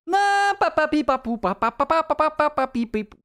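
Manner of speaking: high, loud hammed-up babble; overacted babbling. Real, not acted. Streamer overacting nonsense babble for chat, high and loud. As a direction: ham up nonsense syllables. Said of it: "Un peu d'overact".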